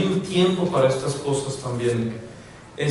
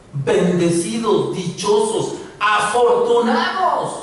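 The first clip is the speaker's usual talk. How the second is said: slow, higher, word-by-word proclaiming; emphatic proclamation; 6 semitones higher, slower than usual. Acted. Preacher quoting Jesus to the congregation, emphatic proclamation. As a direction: proclaim each blessing, building to the last.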